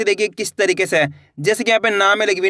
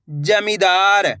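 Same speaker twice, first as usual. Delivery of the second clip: जमींदार with long, held vowels; syllable by syllable; much slower than usual. Shown as a demonstration. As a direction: sound out जमींदार slowly, letter by letter. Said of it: "एक अक्षर का उच्चारण करके". (जमींदार, एक-एक अक्षर खींचकर)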